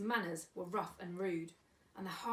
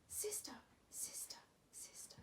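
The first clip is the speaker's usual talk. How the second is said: echo; 6 semitones higher, 15 dB quieter than usual. Acted. Echo from the cave.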